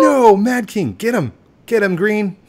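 high, excited calls to a teammate; urgent cheering-on. Real, not acted. Player urging Green to get Mad King, excited. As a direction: call out loud and high, urging the attack.

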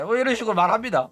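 usual speaking voice

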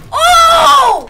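sudden loud high yelp; startled shout. Real, not acted. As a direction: shout it out in shock. Sudden fight, gunfire.

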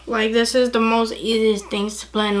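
usual speaking voice

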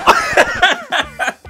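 high-pitched burst of laughter. Real, not acted. Watching a woman stumble in heels, high burst of laughter. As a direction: cracks up at the stumble.

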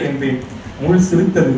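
usual speaking voice

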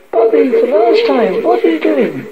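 high-pitched, insistent scolding; exasperated warning. Acted. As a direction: demand an answer from a naughty child. Mother warns child for last time.

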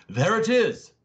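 surprised cry. A narrator's reading. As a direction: shout it out, amazed at the sight. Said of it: "he cried in a tone of considerable surprise".

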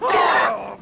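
ordinary talk, nothing marked

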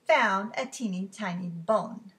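plain reading voice

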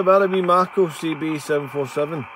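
ordinary talk, nothing marked

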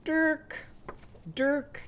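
high, level seal cry; seal call. Shown as a demonstration. Teacher answering as Daisy Dragon's seal, high seal call. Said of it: "What would Daisy Dragon seal say?".

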